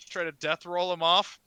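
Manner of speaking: normal talking voice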